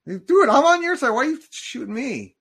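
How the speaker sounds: high, loud, indignant yell; real shock at being betrayed; outraged yelp. Real, not acted. Shot by an ally in game.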